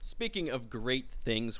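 usual speaking voice

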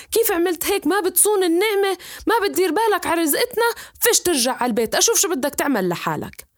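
a father berating his son and throwing him out; angry father shouting. Acted. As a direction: yell at the son who lost the fish. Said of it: "صار يصيح عليه".

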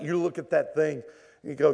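normal talking voice

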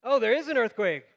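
high, loud jolt of alarm; startled realization. Retold or imitated. Sleeper waking to find the earthquake real, startled. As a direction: burst out, leaning on IS. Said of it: "panic and freak out".

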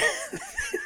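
high wheezy giggle; short real burst of breathy giggles; helpless laughter. Real, not acted. Cracks up at the line.

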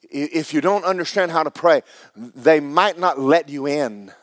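loud, high revival preaching; fired-up preacher; preaching at full force. Acted. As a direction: preach it out to the crowd.

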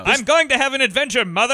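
gleeful movie-star voice; Cary Grant impression. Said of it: "We're all doing great Cary Grant impressions here".

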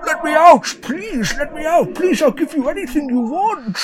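high pleading dragon, begging desperately. Acted. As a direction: beg to be let out in a high, frantic voice. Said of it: "he said".

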